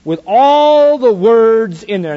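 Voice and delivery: slow emphatic telling. Shown as a demonstration. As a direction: spell it out slowly. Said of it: "Peter got it slow".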